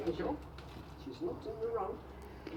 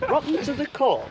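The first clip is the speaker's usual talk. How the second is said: laughing taunt; 8 semitones higher, 9 dB louder than usual. Real, not acted. Mocking insult with laugh.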